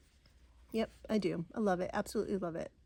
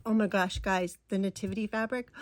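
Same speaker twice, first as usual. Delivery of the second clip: normal talking voice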